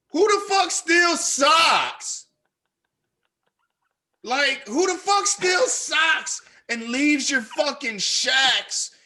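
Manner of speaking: loud, high, incredulous shout; furious outburst over socks; outraged yelling. Real, not acted. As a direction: yell it in disbelief. Outraged rant about stolen socks.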